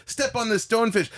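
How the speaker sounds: high-pitched comic build-up; cartoonish exclamation. Comedian acting out finally stepping on the fish, cartoonish exclamation. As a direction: call it out high, heading for the scream. Acted climax of stonefish routine.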